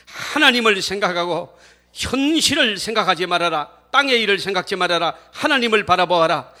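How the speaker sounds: Moses crying out to the crowd, forceful and building; loud urgent commands, climbing to a shout; earnest loud proclamation. Acted. Preacher as Moses calling to the resentful people, earnest outcry. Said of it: "간곡하게 외칩니다".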